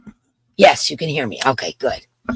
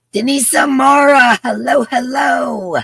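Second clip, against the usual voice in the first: calling out; 9 semitones higher, 7 dB louder than usual. Real, not acted. Host greets a viewer by name, calling out high and long.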